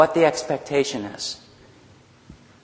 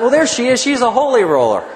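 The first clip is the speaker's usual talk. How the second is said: laughing through it, higher and louder; amused thought; 7 semitones higher, 5 dB louder than usual. Retold or imitated. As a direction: chuckle at the holy roller. Recalling his amused thought.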